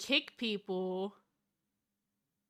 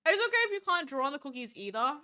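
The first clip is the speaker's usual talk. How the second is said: usual speaking voice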